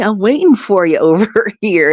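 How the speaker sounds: ordinary talk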